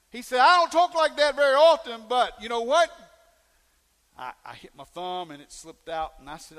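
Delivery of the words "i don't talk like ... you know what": high, loud imitated excuse; acting out a worked-up church member; loud protest. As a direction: protest it at full voice.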